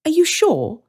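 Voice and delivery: put-on high, boyish hesitant question; a cautious boy checking politely; Hansel's voice. Young Hansel asking the woman, high and careful. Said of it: "Hansel asks".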